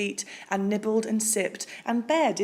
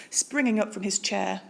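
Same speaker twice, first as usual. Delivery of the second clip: usual speaking voice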